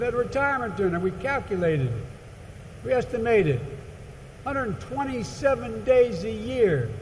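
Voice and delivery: hammed-up conductor teasing his famous passenger; conductor's voice. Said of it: "And he said".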